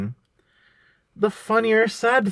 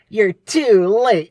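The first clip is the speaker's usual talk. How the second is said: Swiper voice; 4 semitones higher, 5 dB louder, much slower than usual. Pretend. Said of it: "he would say".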